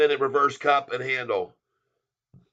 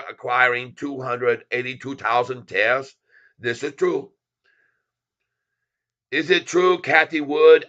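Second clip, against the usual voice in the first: a lightly put-on broken-English accent, playful; put-on foreign accent. Streamer hyping his channel in a put-on accent. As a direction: clip every word in a mock foreign accent. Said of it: "So we go back a voice".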